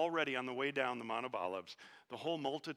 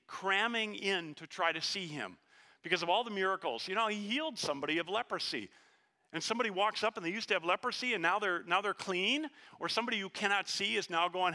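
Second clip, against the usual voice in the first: louder, higher, driving preacher voice; preaching at full force; 5 semitones higher, 3 dB louder than usual. Acted. Preacher stirring the congregation, full-force preaching. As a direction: preach it out to the back row.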